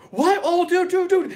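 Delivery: high, loud excited squeal of disbelief; freaking out. Retold or imitated. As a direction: imitate a guy losing it with excitement. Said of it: "He's, like, all freaking out".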